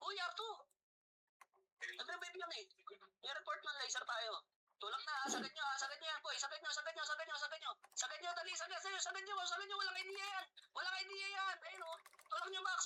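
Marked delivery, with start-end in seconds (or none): none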